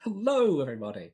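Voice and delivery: high, loud corporate hello; a bright put-on office greeting. Host greeting listeners in a put-on HR voice. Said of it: "This is his H R voice".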